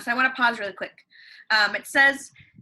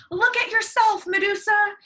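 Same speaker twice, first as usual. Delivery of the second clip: much higher, louder triumphant command; hero's challenge; 12 semitones higher, 5 dB louder than usual. Acted. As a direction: hold up the shield and dare her. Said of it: "he said".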